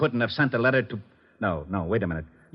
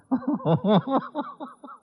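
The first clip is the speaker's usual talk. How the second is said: high, helpless burst of laughter; a high, giddy laugh of total confusion; exasperated laugh; 14 semitones higher than usual. Acted. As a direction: laugh in defeat at the muddle. Lieutenant breaks down in confusion.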